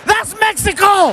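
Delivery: high, bright comic shout; punchline shout. Acted. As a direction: yell the reveal way up high.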